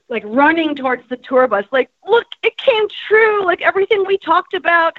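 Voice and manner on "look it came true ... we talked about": higher-pitched, delighted exclamation; excited friend. Retold or imitated. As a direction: voice a thrilled friend running up. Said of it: "came with this huge smile".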